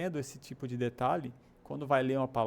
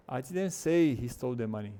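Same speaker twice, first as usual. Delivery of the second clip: "say" stretched and stressed strongly; 4 dB louder than usual. Shown as a demonstration. Teacher showing how words group, stress on "say". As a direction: dwell on "say". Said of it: "nesse segundo formato".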